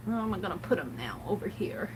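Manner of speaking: soft, half-whispered aside; muttering to herself. Real, not acted. Crafter wondering where to put things, muttered to herself. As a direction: think aloud under your breath. Talking to herself while tidying.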